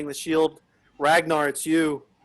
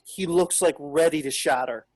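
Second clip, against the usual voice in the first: ordinary talk, nothing marked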